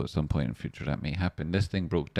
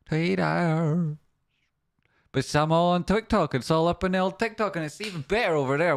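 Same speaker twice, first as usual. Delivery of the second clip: hyped promo; 11 semitones higher than usual. Real, not acted. Hyping his TikTok.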